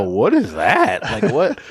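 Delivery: drawn-out, swooping disbelief; mock bafflement. Reacting to a friend's accent slip, mock bafflement. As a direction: act out a laughing, incredulous double take.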